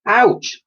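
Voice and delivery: high, sharp cry of pain; ouch cry. Acted. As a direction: yelp as she falls. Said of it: "she cried".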